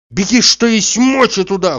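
high, loud urgent yell; urgent shouted order; shouting after someone. A narrator's reading. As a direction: call it after a runner. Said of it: "кричал он ей вслед".

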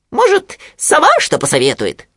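high squeaky piglet making a suggestion; Хрюня. A narrator's reading. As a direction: pipe up a tentative idea. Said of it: "предположил Хрюня". (писклявый голос поросёнка, предлагая)

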